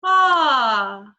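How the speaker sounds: open, loud ah; open, non-nasal ah. Shown as a demonstration. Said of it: "you get a sound that is non-nasal".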